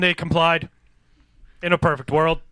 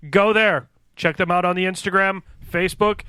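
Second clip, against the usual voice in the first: usual speaking voice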